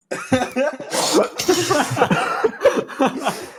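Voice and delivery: long, high fit of giggles; real helpless laughter. Real, not acted. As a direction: crack up at your own acting. Laughing at failed girl voice.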